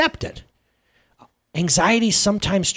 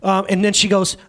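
normal talking voice

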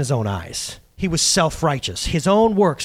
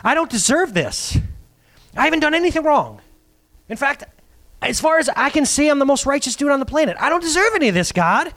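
a hint of a whining Job, pitched higher; lightly voiced indignant Job; 7 semitones higher than usual. Pastor voicing Job protesting to God, lightly whiny. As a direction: protest your innocence a little higher. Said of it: "says Job".

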